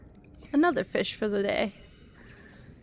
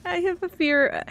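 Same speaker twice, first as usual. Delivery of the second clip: laughing near tears; 5 semitones higher, 5 dB louder than usual. Real, not acted. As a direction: laugh through the fear. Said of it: "I'm gonna cry".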